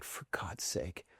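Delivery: slow, very soft exasperated aside; retold weary mutter under the breath. Hearing about wife's accident, soft and slow exasperation.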